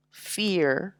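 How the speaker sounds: ordinary talk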